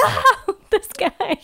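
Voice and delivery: laughing recognition. Retold or imitated. Recalling the creepy actor.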